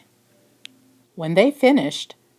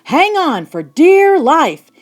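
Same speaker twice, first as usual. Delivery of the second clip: urgent warning; 7 semitones higher, 6 dB louder than usual. Acted. Storm at sea, monster rising.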